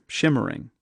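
ordinary delivery, nothing marked

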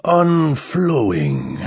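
slow, drawn-out disappointed machine voice; disappointed alien. Acted. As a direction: let the word sag with disappointment. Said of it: "with something that sounded like disappointment".

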